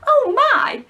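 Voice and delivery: high, loud alarmed cry; a girl suddenly scared; frightened cry. Acted. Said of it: "cried Wendy".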